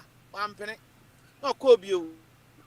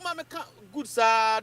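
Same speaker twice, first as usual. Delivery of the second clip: ordinary delivery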